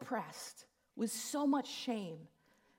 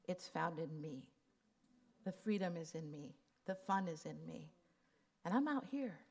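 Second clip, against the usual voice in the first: much softer, lower, gentle voice; tender reassurance; 3 semitones lower, 4 dB quieter than usual. Retold or imitated. Jesus comforting her, soft and low and tender. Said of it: "He says".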